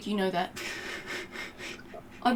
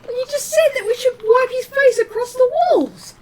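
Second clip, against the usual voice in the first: mock indignant; 13 semitones higher than usual. Indignant protest in banter.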